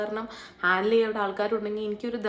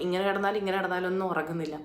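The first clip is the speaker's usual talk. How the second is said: normal talking voice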